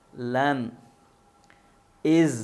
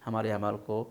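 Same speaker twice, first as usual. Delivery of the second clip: closing prayer; 4 semitones lower than usual. Real, not acted. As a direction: pray gently for the students in Ramadan. (نرم اور دھیمی آواز میں دعا)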